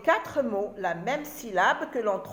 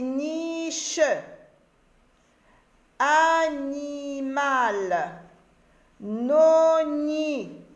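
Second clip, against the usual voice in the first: very slow, held syllables on a high, level note; 5 semitones higher, much slower than usual. Shown as a demonstration. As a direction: chant "niche, animal, noni, puni" slowly for learners. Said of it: "Je répète".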